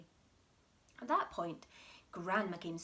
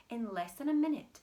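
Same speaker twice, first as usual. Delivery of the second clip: usual speaking voice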